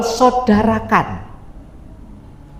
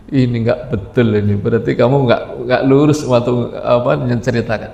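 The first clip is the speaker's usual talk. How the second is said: lower, reproachful accusation; accusing friend; 10 semitones lower than usual. Acted. A modern friend accuses the go-between of dishonesty, lower voice. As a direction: accuse him low and bitter.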